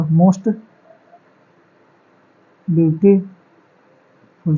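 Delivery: "Most beautiful" drawn out very slowly, word by word; slow dictation. Shown as a demonstration. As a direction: dictate it slowly while writing it on the board. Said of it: "most beautiful हो जाएगा". (Board पर लिखते हुए बहुत धीरे-धीरे बोलना)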